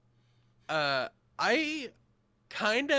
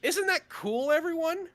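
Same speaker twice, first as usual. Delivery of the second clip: sarcastic; 8 semitones higher, 3 dB louder than usual. Pretend. Sarcastic enthusiasm about callback.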